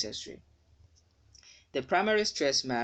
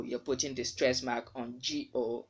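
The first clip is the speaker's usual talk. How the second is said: usual speaking voice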